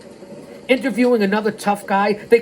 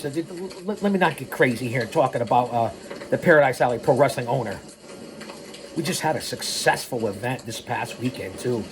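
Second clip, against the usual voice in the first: ordinary talk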